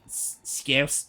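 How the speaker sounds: usual speaking voice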